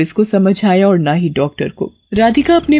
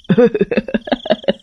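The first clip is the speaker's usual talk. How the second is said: high witch's cackle; evil cackle; 4 semitones higher than usual. Acted. Witch laughing at the trapped driver, evil cackle. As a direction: cackle over your victim.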